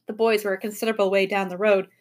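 ordinary delivery, nothing marked

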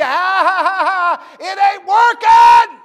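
high, loud taunting cackle then shout; the mocking world laughing and shouting at a struggling believer. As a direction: jeer like the world laughing at you.

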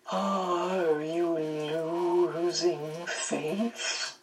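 soft, extremely drawn-out question; Missus Witch. A narrator's reading. Missus Witch testing Meg's faith, in a soft, slow, drawn-out voice. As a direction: stretch each word slowly, rising at the end. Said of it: "Missus Witch's voice came".

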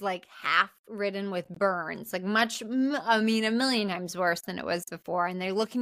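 ordinary delivery

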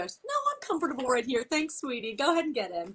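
high, syrupy sweet put-on politeness; sugary fake nice. Shown as a demonstration. Parent to the principal in the drop-off line, sickly sweet. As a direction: kill them with kindness. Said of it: "Just be super nice".

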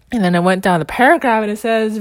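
usual speaking voice

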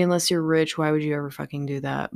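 ordinary talk

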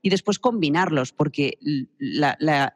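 ordinary delivery

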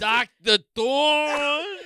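imitating a bad British accent, laughing; very slow, high, exaggerated British; mock British accent. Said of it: "if that was your British accent".

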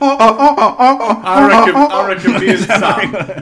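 giggling through the words; laughing. Real, not acted. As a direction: laugh it out, slow. Laughing while speaking.

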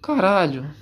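usual speaking voice

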